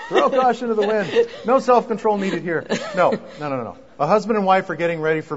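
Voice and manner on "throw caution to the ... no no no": laughing it out, high and bright. Real, not acted. Husband laughing at his own joke about letting yourself go, laughing. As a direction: chuckle through the joke.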